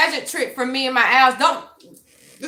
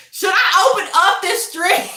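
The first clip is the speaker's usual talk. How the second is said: nervous laugh; 6 semitones higher, 4 dB louder than usual. Real, not acted. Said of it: "I'm scared".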